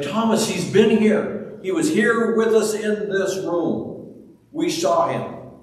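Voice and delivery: high, loud eager telling; excited disciples. Retold or imitated. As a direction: burst out the good news.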